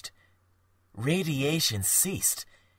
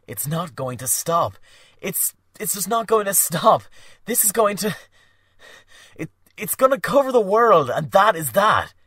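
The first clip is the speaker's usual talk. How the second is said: alarmed outburst; 8 semitones higher, 10 dB louder than usual. Acted.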